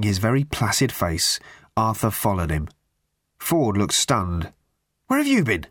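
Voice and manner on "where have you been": shrill, high demand. A narrator's reading. Ford demanding where Arthur has been, pitched high. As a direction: shoot the question up high. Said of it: "he demanded".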